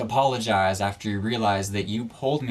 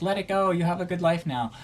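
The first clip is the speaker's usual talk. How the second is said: mock commenter; 8 semitones higher than usual. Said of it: "some of you are probably gonna be like".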